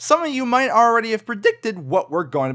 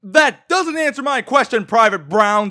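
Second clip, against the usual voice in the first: loud drill instructor reprimand; drill sergeant; 5 semitones higher, 5 dB louder than usual. Acted. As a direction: chew out the private. Drill sergeant berating recruit.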